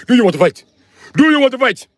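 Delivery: high, loud mock African accent. Imitating Africans asking to fight, loud mock African accent. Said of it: "If Africans say".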